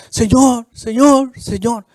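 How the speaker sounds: loud, high pleading "Señor"; calling out to God in prayer; fervent crying out. Acted. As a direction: cry out to God over and over. Voiced fervent prayer in sermon.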